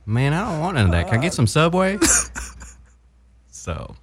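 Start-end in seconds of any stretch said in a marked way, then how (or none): 0.1-2.0 put-on high, whiny Southern-accented kid. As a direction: protest to the parent in a Southern twang. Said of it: "a brown guy with a Southern accent".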